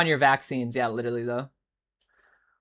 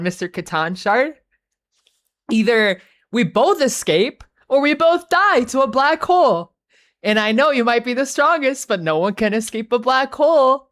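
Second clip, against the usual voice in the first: mock taunting Cawl; 6 semitones higher, 4 dB louder than usual. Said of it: "and then he goes".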